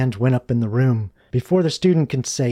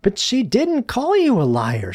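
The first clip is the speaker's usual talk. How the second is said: high-pitched objecting schoolboy; protesting pupil; 10 semitones higher, 4 dB louder than usual. A narrator's reading. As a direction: speak up in a higher student voice. Luke objects to angry teacher.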